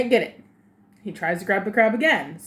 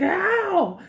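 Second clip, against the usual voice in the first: mock yelp of pain; 9 semitones higher than usual. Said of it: "the dad's like".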